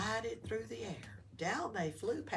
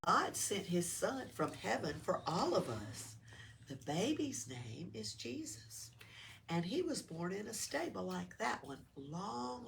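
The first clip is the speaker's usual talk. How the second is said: usual speaking voice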